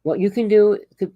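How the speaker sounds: usual speaking voice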